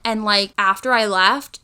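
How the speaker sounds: normal talking voice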